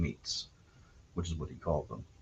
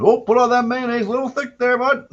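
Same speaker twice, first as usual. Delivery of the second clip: much higher, louder gleeful prairie dad; dad impression, prairie accent; 13 semitones higher, 5 dB louder than usual. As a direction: egg the kid on, laughing at him. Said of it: "I can give you a pretty good impression".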